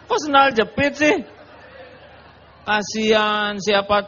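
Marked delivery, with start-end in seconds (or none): 0.1-1.2 laughing surprise. Retold or imitated. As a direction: act shocked between laughs. Mock shock at sandal reaction.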